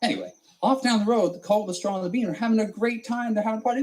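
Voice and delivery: ordinary delivery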